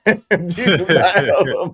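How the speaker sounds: slow, laughing through the words. Real, not acted. Host repeats the caller's claim through laughter. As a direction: crack up as you repeat it.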